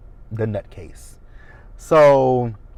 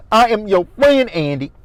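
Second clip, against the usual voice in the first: high, loud mock of her accent; Karen's accent; 9 semitones higher, 7 dB louder than usual. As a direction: plead it whiny, like Karen. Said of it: "that accent that she has".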